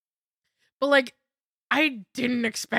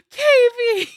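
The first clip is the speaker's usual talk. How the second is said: much higher, loud delighted cry of a name; joyful recognition squeal; 13 semitones higher, 10 dB louder than usual. Retold or imitated. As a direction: shriek the name on recognizing her. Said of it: "And it was just like".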